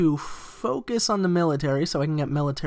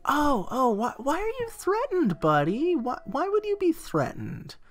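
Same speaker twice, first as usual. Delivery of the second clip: coaxing; 6 semitones higher, 3 dB quieter than usual. Pretend. Coaxing a threatened AI nation.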